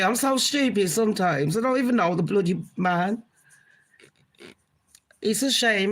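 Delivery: normal talking voice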